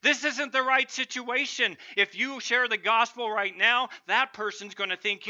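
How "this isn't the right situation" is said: higher, louder mock bark at the listener; sneering evil voice barking orders; barking dog of evil. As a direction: snap it out like a scornful inner voice. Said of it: "It barks out".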